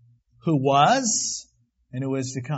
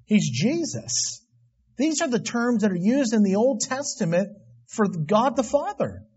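ordinary delivery

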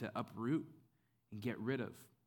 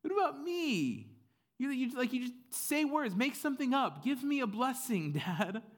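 high, loud pleading whine; hammed-up wail to a father; mock weeping; 14 semitones higher, 6 dB louder than usual. Esau begs Dad for a blessing, mock tearful wail. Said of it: "He just starts weeping".

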